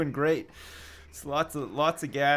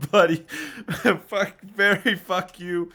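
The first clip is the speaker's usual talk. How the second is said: laughing, louder imitation in an Indian accent; mock Indian accent; 5 semitones higher, 10 dB louder, slower than usual. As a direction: echo the clip through laughter, Indian accent. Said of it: "Oh, I love their accents".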